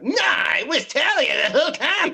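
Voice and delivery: high, loud whining mockery; mock the twist with a whining yell; whiny mock shout. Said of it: "be like".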